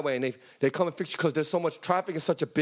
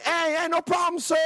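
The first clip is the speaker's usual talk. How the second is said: high friendly helper voice; cheerful young worker reassuring him; tow guy voice. Retold or imitated. Tow truck guy reassuring the stranded driver, high cheerful voice.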